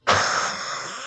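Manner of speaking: silent disbelieving snicker; a breathy burst of laughter; airless laugh. Acted. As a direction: laugh without voice, almost stunned. After repeating "daddy.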